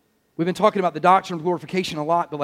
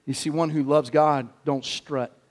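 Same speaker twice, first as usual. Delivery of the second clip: normal talking voice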